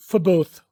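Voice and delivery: For both with for cut to fə; weak fə glued onto both; teacher demo of linking; linked for both. Shown as a demonstration. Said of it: "जो आवाज़ है उसको अगले word के साथ".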